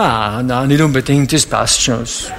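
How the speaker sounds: a hint of a reluctant young man. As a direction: brush the question off, mock-evasive. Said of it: "Dann sagt er".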